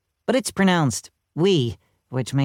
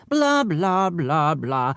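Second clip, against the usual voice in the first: droning lecturer; much slower than usual. A narrator's reading. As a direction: drone on like a tedious professor. Lecture drones on.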